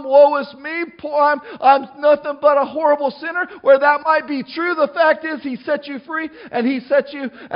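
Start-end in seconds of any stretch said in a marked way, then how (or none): 0.0-3.5 high, louder woe-is-me lament; humdrum self-pity. Acted. Preacher mimicking a believer stuck in guilt, mock self-pity. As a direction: moan it like a mock self-pitying sinner. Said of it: "not to walk around all humdrum".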